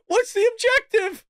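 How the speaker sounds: very high quote squeezed out laughing; real incredulous laughing squeal; crying with laughter. Real, not acted. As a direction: read it while cracking up. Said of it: "Oh, God, I'm crying".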